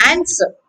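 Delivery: "answer" with the flat American A, falling; American pronunciation. Shown as a demonstration. Teacher modelling the American version for students. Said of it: "This is in American English".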